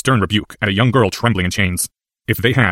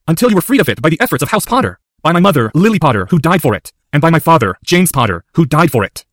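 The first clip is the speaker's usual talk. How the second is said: higher, emphatic courtroom plea; impassioned boy; 7 semitones higher, 3 dB louder than usual. A narrator's reading. Boy reminds the lord of his debt, impassioned. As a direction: press each point home, high and forceful.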